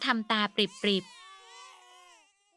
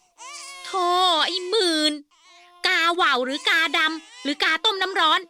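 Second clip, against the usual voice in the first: louder, higher exasperated scolding; annoyed retort; 7 semitones higher, 5 dB louder than usual. Acted. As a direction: brush off a nosy friend.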